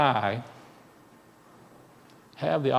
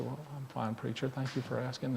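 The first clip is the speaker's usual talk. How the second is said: shy quiet reply; 12 dB quieter than usual. A narrator's reading. Shy boy answers preacher.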